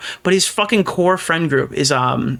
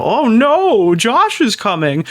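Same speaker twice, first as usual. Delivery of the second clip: 1 mock panic; 7 semitones higher, 3 dB louder, much slower than usual. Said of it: "like, go".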